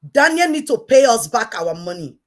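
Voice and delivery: a wronged woman demands her money back from Daniel, shouting; angry demand. Real, not acted.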